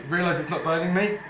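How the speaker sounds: ordinary delivery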